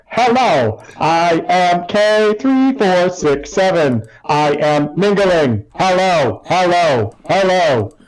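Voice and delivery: slow, mechanical, evenly spaced hellos; robot greeting. Acted. Robot Kay mingling in the tavern, slow and mechanical. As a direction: announce yourself like a stiff machine. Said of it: "is just like".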